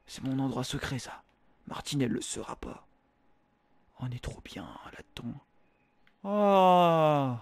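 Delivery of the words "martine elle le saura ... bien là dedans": whispering. Acted. Hiding in the secret jacuzzi.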